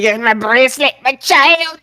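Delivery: mock whine in Drew's Scottish accent; CM Punk. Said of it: "had the same accent that Drew had".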